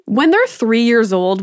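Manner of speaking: normal talking voice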